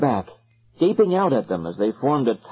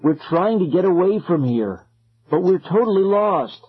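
understated distress, rising higher; distressed cry; 5 semitones higher than usual. A narrator's reading. Ben explaining to circle, mounting distress. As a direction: build the appeal higher toward the end. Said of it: "Ben cried".

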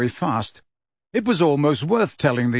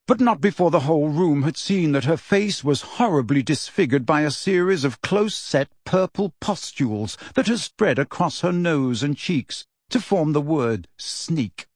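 plain reading voice

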